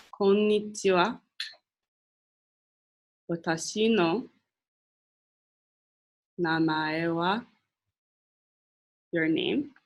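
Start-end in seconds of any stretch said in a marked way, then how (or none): 0.1-7.4 Konnichiwa. Watashi no namae wa, said slowly with long pauses; slow for repeating. Shown as a demonstration. Teacher leading the class to repeat after her, slow. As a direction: say it slowly so they can echo each part. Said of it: "repeat after me slowly".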